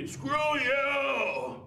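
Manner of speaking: put-on high, yelled, drawn-out drunk insult; hammed-up street shouting; arguing bum. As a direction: holler it like a quarrelling bum. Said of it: "they're always so intellectual arguments".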